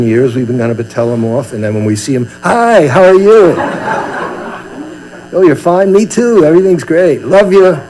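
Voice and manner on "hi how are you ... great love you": high, loud, sugary mock friendliness; fake sweet greeting. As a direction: gush over them as if nothing was wrong. Wanted to scold, greets sweetly.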